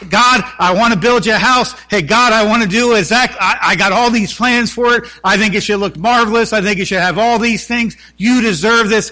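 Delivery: eager David. Pretend. David begging God to let him build, eager and loud. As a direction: plead your big plans to God.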